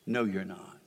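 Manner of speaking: ordinary delivery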